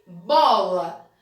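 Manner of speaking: Bola said low and loud, drawn out; slow clear model word. Shown as a demonstration. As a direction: announce Bola slowly for the class. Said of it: "a primeira palavra aí é o quê".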